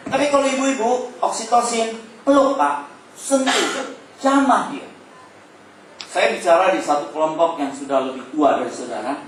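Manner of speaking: ordinary delivery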